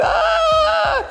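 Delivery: very high-pitched shriek; comic scream. Acted. Man discovering the pregnancy, comic high scream. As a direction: let out a long mock scream.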